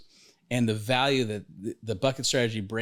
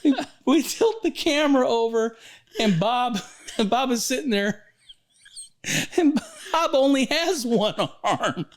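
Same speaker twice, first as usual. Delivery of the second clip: laughing through it; 12 semitones higher than usual. Real, not acted. Retelling the one-armed client story to his co-host, helplessly laughing. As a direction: choke out the words while cracking up.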